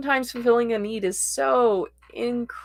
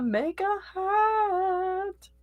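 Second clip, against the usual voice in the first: playful high voice; 11 semitones higher, much slower than usual. Acted. Teasing the witchy craft night.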